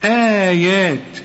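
آيات said slowly with a held آ; slow recited long vowel; long madd. Shown as a demonstration. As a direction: stretch the آ in آيات. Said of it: "علشان تقول آ".

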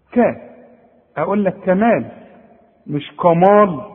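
usual speaking voice

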